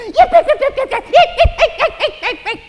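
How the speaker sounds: very high, very loud yapping like a tiny dog; imitated dog yapping. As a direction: yap it out like a cheeky little chihuahua. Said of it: "Trixie runs up to the Great Dane and goes".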